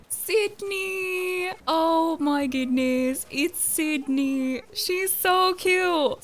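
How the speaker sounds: high, slow cooing over a cute find; delighted squeal. Real, not acted. Gamer spotting Sydney on the island, cooing with delight. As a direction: gush like you just saw a puppy. Finds favorite koala villager.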